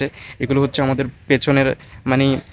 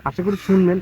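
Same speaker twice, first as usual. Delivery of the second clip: ordinary talk, nothing marked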